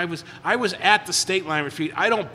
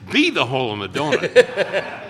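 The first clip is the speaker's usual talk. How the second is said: quoting the sponsor through a laugh; retelling the sponsor's advice with a laugh; sponsor quoted laughing; 6 dB louder than usual. Retold or imitated. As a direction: laugh while delivering the sponsor's line. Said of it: "And my sponsor goes".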